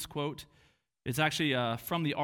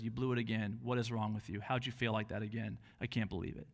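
condemning tone; 7 semitones lower, 6 dB quieter than usual. Retold or imitated. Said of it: "God's tone when he looks down on us".

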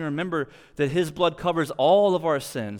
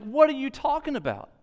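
high, incredulous mock outrage; mock furious; 9 semitones higher than usual. Pharisees scolding their officers, high mock fury. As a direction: throw it back at them, mock furious. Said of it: "the Pharisees are furious".

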